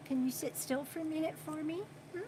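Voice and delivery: hushed, quick plea to the pet; soft coaxing. Real, not acted. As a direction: gently coax it to stay still. Asking pet to sit still.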